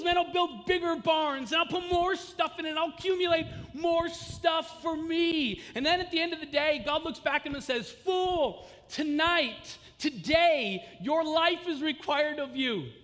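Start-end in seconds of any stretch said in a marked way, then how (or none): 0.0-5.6 greedy rich man. Acted. Rich fool's greedy plan. 7.9-12.9 high, loud, slow judgment; God declaring the fool's fate, loud and high; stern pronouncement. Acted.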